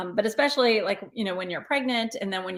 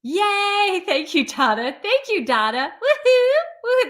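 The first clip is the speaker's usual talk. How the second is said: high squealing cheer, much higher and louder; excited cheering; 13 semitones higher, 5 dB louder than usual. Real, not acted. Host cheering viewers for making her book number one, excited. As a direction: celebrate like the book just hit number one.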